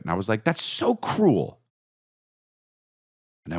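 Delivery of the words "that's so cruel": put-on much higher, slow drawn-out kid whine; sulking child. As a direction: drag it out like a hurt little boy. Child protesting dad.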